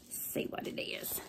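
2 hushed whisper of anticipation; 3 whisper as if peeking at a secret; 4 opening the package in a whisper. Real, not acted.

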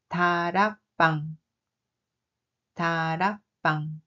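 "다락방" as really spoken, quick and natural; natural everyday "다락방"; real pronunciation. Shown as a demonstration. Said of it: "La prononciation réelle est". (« 다락방 » prononciation réelle, naturelle)

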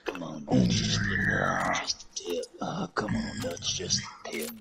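very low, soft groaning voice; low groans. Pretend. Friend teasing the others, low mock groans. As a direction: groan the words out deep and quiet. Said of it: "enough of those low groans".

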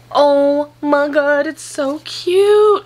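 slow, high, loud gushing; drawn-out squeal over something cute. Real, not acted.

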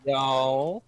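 light put-on Texan drawl; lightly voiced Texan twang; mock Texan. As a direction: ham up a cowboy howdy.

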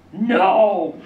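loud, drawn-out cry of disbelief; mock horror. Father hears his children drowned, mock-horrified shout. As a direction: shout it in hammed-up shock.

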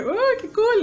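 light up at a cool find; excited delight. Retold or imitated. Discovering the editing app.

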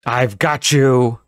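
slow, loud monster scream; scary scream. Acted. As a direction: drag out a menacing scream. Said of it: "she screamed".

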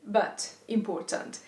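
usual speaking voice

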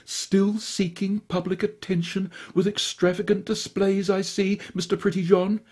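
high, crisp prim disdain; a prim lady snubbing her rival; clear and cutting. A narrator's reading. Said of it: "Evangeline Haddock said with clarity".

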